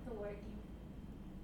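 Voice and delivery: hushed, breathy aside from the side of the room; real whisper. Real, not acted. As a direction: whisper it so the mic barely catches it. Girlfriend whispers across the room.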